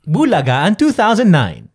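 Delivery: big game show announcer call. Shown as a demonstration. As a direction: sell it like a noontime show intro. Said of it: "Sample nga".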